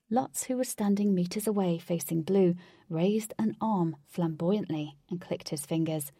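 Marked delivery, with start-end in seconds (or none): none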